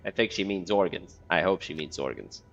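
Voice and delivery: ordinary talk, nothing marked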